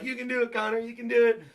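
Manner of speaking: high-pitched retold encouragement; high, lightly hammed-up pep talk; mock cheerleading. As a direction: imitate chat cheering someone on. Imitating chat cheering him on.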